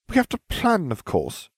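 haughty. Acted. Hermione lectures Harry about planning, a touch haughty. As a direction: look down your nose at him. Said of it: "She sniffed haughtily".

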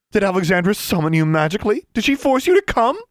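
loud, high, climbing questions; scornful outrage. A narrator's reading. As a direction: challenge her with mounting fury. Mother quarrels with Livia.

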